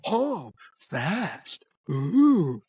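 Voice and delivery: drawn-out creepy cooing; imitated lecherous delight; South Park creep. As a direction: savour it like a cartoon creep, swelling on the last sound. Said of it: "That's how he talked".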